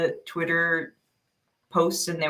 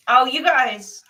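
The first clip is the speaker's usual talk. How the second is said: usual speaking voice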